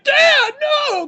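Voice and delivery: high, loud desperate yell, hammed-up; mock cry out. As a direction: shout for your dad in panic. Son runs in crying out.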